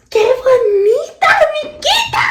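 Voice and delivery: high, loud excited call; high excited shout; shouted greeting. Acted. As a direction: shout it out like spotting a friend.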